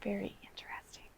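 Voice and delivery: hushed, quick aside; whispered. Real, not acted. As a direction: breathe it out quietly. Teacher whispers reaction.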